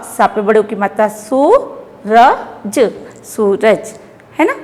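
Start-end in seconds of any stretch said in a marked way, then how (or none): 1.1-2.9 "सू, र, ज" one sound at a time, "सू" held long. Shown as a demonstration. Teacher showing children how to read it, sounded out. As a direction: spell it out slowly, stretching "सू". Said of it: "सूरज को कैसे पढ़ेंगे".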